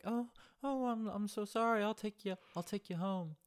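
high, fluttery apologetic lady; flustered fifties housewife; high woman voice. Retold or imitated. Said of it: "She doesn't sound like the voice I'm just doing".

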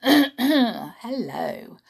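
plain reading voice

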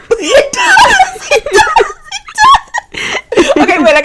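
high laughing repeats; breaking up with laughter mid-line. Real, not acted. Podcaster cracking up at her own Marty impression, high laughing.

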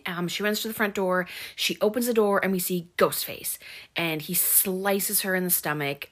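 usual speaking voice

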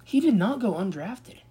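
high, stunned disbelief; can't believe what he's reading; a sharp high cry of disbelief; shocked disbelief. Real, not acted. Shocked at the draft result.

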